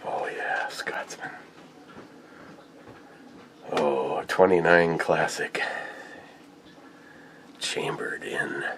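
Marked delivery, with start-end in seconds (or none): 0.0-1.3 hushed breathy whisper; awed whisper. Real, not acted. Whispering over the gun. 7.6-8.7 slow hushed whisper; slow reverent whisper. Real, not acted. Whispering caliber.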